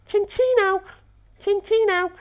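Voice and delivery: very high, loud falsetto squeal; squeaky cutesy voice. Pretend. Gamer presenting his Chinchino, in a high squeaky voice. Squeaks the Pokémon's name playfully.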